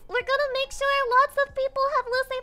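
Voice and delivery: high, loud hyped shout; rally the chat with joy; excited cheer. Real, not acted. Streamer thanking chat for ordering plush, loud excited cheer.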